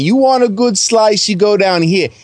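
hammed-up Brooklyn street-food pitch; mock Brooklyn accent. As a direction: play a pushy Brooklyn local giving directions. Plays Brooklyn local pitching food.

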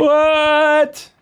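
high, drawn-out mock-shocked cry. Co-host reacts to a movie spoiler, mock-shocked squeal.

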